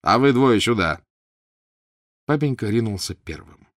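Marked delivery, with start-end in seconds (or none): 0.0-1.0 loud, higher imperious orders; commanding shout. A narrator's reading. Prince sends barber off and calls the Karpovs, loud commands. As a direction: snap the orders out.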